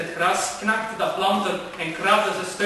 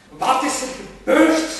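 startled; 5 semitones higher, 6 dB louder, slower than usual. Acted. Man startled by the flower.

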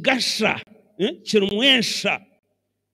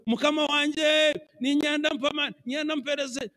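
higher, slow pleading to God; pleading prayer; 8 semitones higher, slower than usual. Retold or imitated. Preacher voices someone pleading with God in prayer, higher. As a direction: beg the Lord humbly, pitched up.

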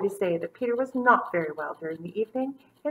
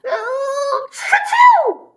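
loud high mock sneeze; a big hammed-up achoo; 12 dB louder than usual. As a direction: burst out with a cartoon sneeze. Peter sneezes in story.

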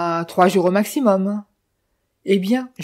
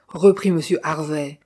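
ordinary delivery, nothing marked

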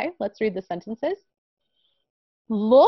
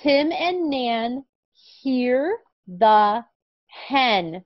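very slow, clear reading; slow reading aloud; 3 semitones higher, much slower than usual. Shown as a demonstration. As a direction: model the sentence word by word. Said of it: "one more time. Let's read".

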